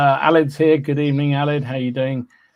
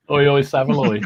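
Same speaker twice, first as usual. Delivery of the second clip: cheeky catchphrase call; 6 dB louder than usual. Pretend. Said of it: "I wanted to say Savaloy as soon as you said oi".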